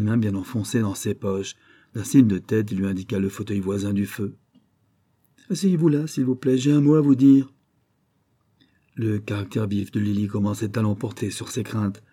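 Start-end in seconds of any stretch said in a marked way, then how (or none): 5.5-7.5 commanding. Acted. Gus orders Lily to sit.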